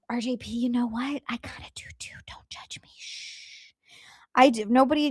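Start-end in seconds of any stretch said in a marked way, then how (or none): 0.1-3.7 hushed confession; whisper. Real, not acted. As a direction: whisper it like a secret. Confessing a secret to chat.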